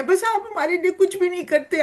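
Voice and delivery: put-on high, fast whiny protest from a child; childish complaint. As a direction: blurt it out like a sulky kid. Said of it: "ہم بھی اپنے امی ابو کو کہتے ہیں". (تیز اور اونچی شکایتی آواز)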